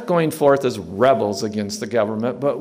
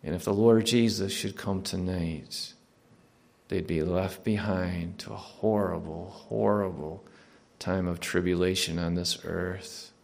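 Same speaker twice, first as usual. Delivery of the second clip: softer, even-pitched prayer voice; closing prayer; 2 semitones lower, 4 dB quieter than usual. Real, not acted. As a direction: pray earnestly, subdued after the preaching.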